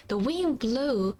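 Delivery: usual speaking voice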